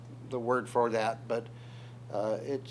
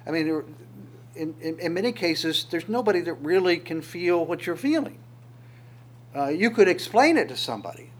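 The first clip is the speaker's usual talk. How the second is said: normal talking voice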